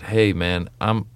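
ordinary talk

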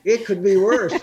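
Yiddish grandmother. Retold or imitated. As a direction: punch "It" high, with a laugh.

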